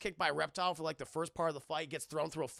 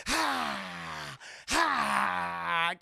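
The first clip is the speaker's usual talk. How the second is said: long airy "haaa", held out; two drawn-out mock cries, the second louder; imitated Sindel cry; 5 dB louder than usual. Imitating Sindel perched on a pillar, in long hammed-up "haaa" cries. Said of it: "on a pillar like this, going".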